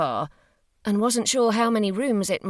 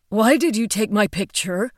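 louder, direct, no longer robotic; sudden natural voice; 3 semitones higher, 5 dB louder than usual. Acted. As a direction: drop the robot voice, ask directly. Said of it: "bypassing his robot voice and sounding like a completely different person".

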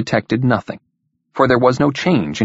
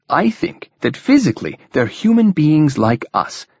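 higher, eager explanation; 6 semitones higher than usual. A narrator's reading. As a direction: press your theory with keen interest. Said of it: "continued eagerly".

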